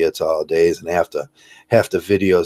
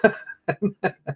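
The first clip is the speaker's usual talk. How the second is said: high giggle; 5 dB quieter than usual. Real, not acted. Laughing after the mock campaigner.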